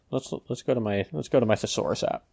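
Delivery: robotic voice from a breaking-up connection; glitchy robotic voice dropping in and out. Real, not acted. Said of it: "your voice is going in and out of, like, very robotic".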